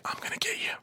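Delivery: whispered, creepy and low. Retold or imitated. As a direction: hiss it like a lurking grandma. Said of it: "she's, like, mumbling shit".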